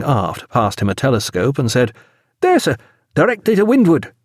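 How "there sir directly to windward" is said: high-voiced young midshipman; eager youngster. A narrator's reading. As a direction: report it keenly in a high boyish voice. Eager young Reed points out ship.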